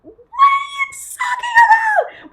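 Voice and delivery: screaming high and loud in disbelief; real furious scream; outraged shout. Real, not acted. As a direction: yell it at the screen. Ranting at Bloom's friends.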